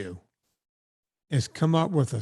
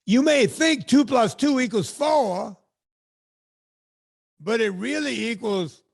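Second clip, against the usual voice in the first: preacher voice; 5 semitones higher, 3 dB louder than usual. Pretend. Playing his new preacher persona, loud and high. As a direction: preach it big to the pews.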